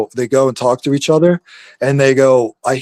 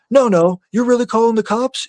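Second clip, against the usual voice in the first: higher, mock incredulous wife; a touch of a shrill-free raised wife voice; wife's mock high voice; 9 semitones higher than usual. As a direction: play the wife in a raised pitch. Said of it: "And my wife goes".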